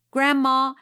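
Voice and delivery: loud, high wail for Grandma; boy bawling to Grandma after the fight. A narrator's reading. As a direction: cry out for Grandma. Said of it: "he bawled".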